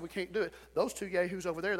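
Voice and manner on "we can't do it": soft, low, grumbling can't-do-it; a quiet, low, defeated grumble; mumbled defeatism. Retold or imitated. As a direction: mutter it like a beaten man. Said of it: "We all said".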